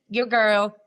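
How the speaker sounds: normal talking voice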